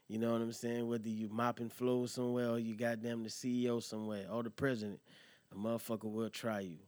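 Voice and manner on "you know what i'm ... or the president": ordinary talk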